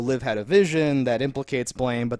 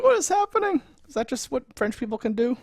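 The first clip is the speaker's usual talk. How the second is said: mock bafflement; 10 semitones higher than usual. His own baffled reaction retold.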